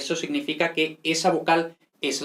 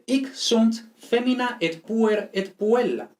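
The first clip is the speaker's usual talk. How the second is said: slow Latin reading; 7 semitones higher, slower than usual. Shown as a demonstration. Teacher reads Latin sentence again.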